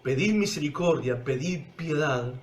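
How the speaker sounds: low, soft sermon voice sinking to a hush; intimate preaching. Acted. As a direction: draw the listeners close, quietly. (predicación íntima, baja y suave)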